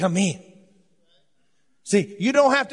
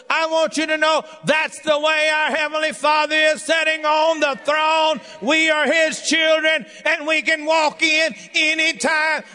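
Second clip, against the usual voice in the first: full-force preaching; 7 semitones higher than usual. Acted. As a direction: shout the good news to the congregation.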